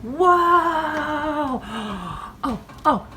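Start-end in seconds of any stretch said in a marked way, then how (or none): 0.0-1.6 surprise. Real, not acted. Bread out of oven. 1.7-2.4 gasp. Real, not acted. Sees the baked loaf.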